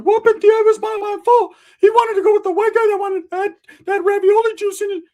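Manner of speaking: very high, loud squeaky falsetto; Ricky. Retold or imitated. Imitating cousin blaming others to mom, high falsetto.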